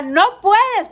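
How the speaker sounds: mock loud no. People forbidding her food, mocking a loud high no. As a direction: bark the forbidding NO like a strict doctor. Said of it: "no hay nada más horrible que te diga".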